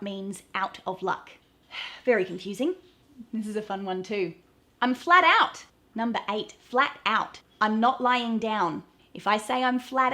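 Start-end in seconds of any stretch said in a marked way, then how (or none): 4.8-5.6 punchy example. Shown as a demonstration. Example phrase.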